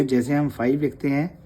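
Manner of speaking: ordinary delivery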